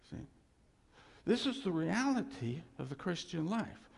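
normal talking voice

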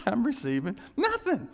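laugh, then pitch jumps up on "nothing"; exasperated emphatic admission. Acted. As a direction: blurt it high with amused exasperation. Repeats it louder after a laugh.

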